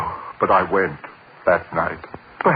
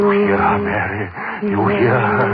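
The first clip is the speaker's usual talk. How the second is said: frightened plea; 10 semitones higher than usual. Acted. Daryl hears the woman.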